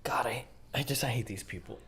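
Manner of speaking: very low, hushed despair; exasperated whisper. Real, not acted. Reactor despairing at these people, exasperated whisper. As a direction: mutter it under your breath, nearly voiceless.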